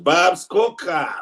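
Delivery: high, drawn-out name call. Real, not acted. Calls out a viewer's name.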